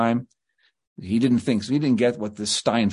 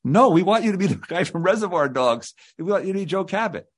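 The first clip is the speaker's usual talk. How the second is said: higher, pressing voice of the producers; producers insisting; 6 semitones higher than usual. Retold or imitated. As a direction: push back hard on the star, a bit exasperated. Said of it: "they're like".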